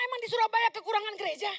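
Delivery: fast, loud playful challenge; playfully asking if Surabaya has no churches; teasing rhetorical question. Retold or imitated. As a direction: tease them about coming so far. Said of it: "Gue bilang".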